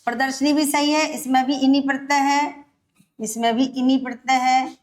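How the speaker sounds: ordinary talk, nothing marked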